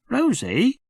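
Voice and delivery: put-on high, puzzled little-boy voice; a small child wondering aloud; small boy Jim. Little Jim asks his sister why it's dark, high boy's voice. Jim's voice.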